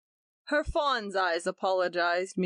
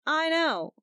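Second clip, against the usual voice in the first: resentful; 8 semitones higher than usual. A narrator's reading. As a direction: cut him off with resentment. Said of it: "interrupted Gretchen resentfully".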